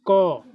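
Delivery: the sound क voiced alone as "kaw". Shown as a demonstration. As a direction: demonstrate क as one open, held sound. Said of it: "एक ध्वनि निकाल रहे हैं".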